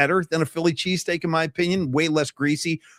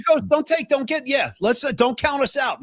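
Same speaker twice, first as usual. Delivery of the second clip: excited; 7 semitones higher than usual. Real, not acted. Defending his team.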